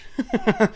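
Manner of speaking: brief, soft chuckle. Real, not acted. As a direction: chuckle at your own gag. Laughs at his own refresh gag.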